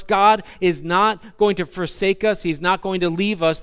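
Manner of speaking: ordinary talk, nothing marked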